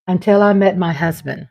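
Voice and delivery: usual speaking voice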